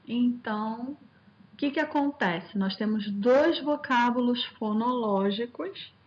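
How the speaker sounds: ordinary delivery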